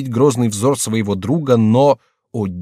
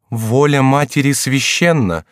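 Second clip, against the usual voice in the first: mildly raised, slow and emphatic; exclaiming; 5 semitones higher, 5 dB louder, slower than usual. A narrator's reading. Mother asserting her will to her son, exclaiming. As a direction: proclaim it higher, louder, drawing it out. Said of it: "вскричала Екатерина".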